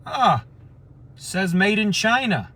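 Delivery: high mock-surprised "Ah", then a put-on voice; sarcastic joke. Joking to his friend about the purse, high mock surprise. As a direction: fake discovery, dripping with sarcasm. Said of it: "I was just being sarcastic".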